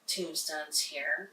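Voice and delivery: ordinary talk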